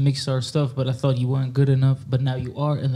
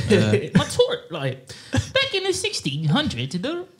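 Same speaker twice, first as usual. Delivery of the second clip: put-on high, slow old-timey tour guide; tourist guide voice; 7 semitones higher, slower than usual. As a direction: narrate like a tour guide from long ago. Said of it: "You have to use your tourist guide voice also".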